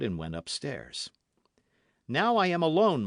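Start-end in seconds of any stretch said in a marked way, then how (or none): 2.1-3.0 high, loud announcement; calling out. Acted. D'Artagnan alone calls to Athos, loud raised voice. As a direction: call through the door to a friend.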